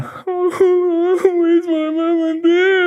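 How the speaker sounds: put-on high, wailing little-boy cry, slow; lost child crying. Four-year-old lost on the beach calling for his parents, crying high.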